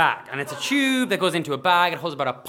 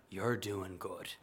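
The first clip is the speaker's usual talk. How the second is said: low, soft, slow delivery; understated fatherly reassurance; quiet approval; 6 semitones lower, 11 dB quieter, much slower than usual. Retold or imitated. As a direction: say it gently, like a small nod. Father's approving nod put into words.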